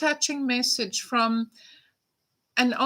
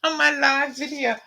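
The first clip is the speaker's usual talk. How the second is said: close to tears. Real, not acted. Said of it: "I'm gonna start crying".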